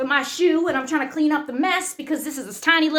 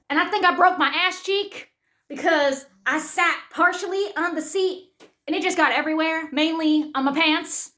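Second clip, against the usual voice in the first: normal talking voice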